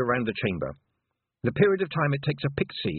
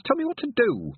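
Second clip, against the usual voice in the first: high, pleading demand; 4 dB louder than usual. Acted. Tiffany begging the toad for advice, high and urgent. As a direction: Tell me what to do pushed high.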